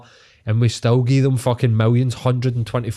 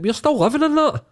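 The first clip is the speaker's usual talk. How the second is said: incredulous outburst; 13 semitones higher than usual. Retold or imitated. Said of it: "You're like".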